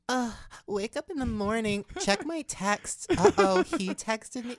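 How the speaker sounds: high, slow mock vlog narration; hammed-up singsong influencer voiceover, drawn out; Gigi voiceover impression. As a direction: parody a YouTuber narrating her morning. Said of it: "the one that you did recently".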